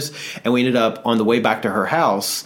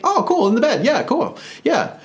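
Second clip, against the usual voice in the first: much higher, naive and oblivious; high clueless voice. Retold or imitated. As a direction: squeak it out, totally clueless. His oblivious younger self.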